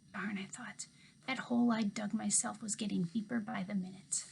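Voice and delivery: usual speaking voice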